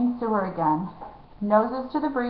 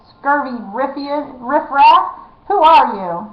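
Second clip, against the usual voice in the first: angry shout; 4 semitones higher, 6 dB louder, slower than usual. A narrator's reading. Rat catcher still bellowing.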